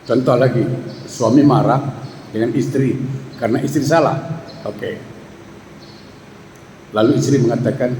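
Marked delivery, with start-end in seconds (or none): none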